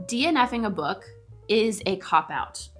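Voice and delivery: ordinary talk, nothing marked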